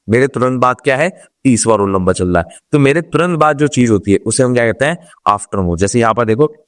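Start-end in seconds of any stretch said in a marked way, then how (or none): none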